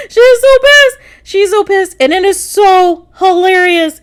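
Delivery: high, squealing amusement easing down; cracking up. Real, not acted. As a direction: squeal in delight, barely holding in laughter. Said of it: "I am just cracking up".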